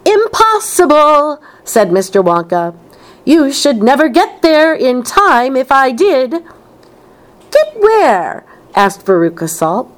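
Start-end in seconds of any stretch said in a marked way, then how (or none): 0.0-6.4 high-pitched emphatic refusal; calling back briskly. A narrator's reading. Wonka calls back while hurrying. 7.5-8.4 very high, insistent question; demanding child. A narrator's reading. Veruca questions Wonka on the way, demanding child.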